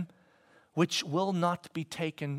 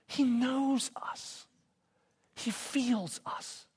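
tender slow; 7 semitones higher, 5 dB quieter, much slower than usual. Real, not acted. Tender preaching about Jesus.

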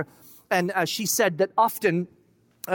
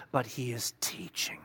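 softly whispered, slower; 8 semitones lower, 9 dB quieter than usual. Real, not acted. Preacher to congregation about Jesus teaching, hushed whisper. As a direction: lean in and breathe it.

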